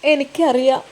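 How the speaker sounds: halting, tearful words, long pauses between; put-on crying. A narrator's reading. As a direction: break off as if choked by tears. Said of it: "അവൾ അങ്ങ് കരഞ്ഞ് അഭിനയിച്ചു".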